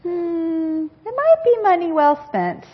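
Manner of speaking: hint that she's in over her head; doubtful parents. Retold or imitated. Parents gently doubting her DIY painting plan, knowing tone. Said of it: "my parents said".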